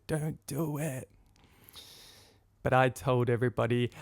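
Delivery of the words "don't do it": slow, soft, half-voiced plea; hushed warning. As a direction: draw it out under your breath. Repeats Julia's silent warning.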